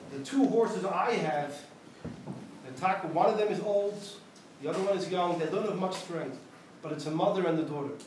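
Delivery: ordinary talk, nothing marked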